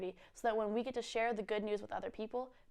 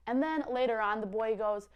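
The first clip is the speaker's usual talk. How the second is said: usual speaking voice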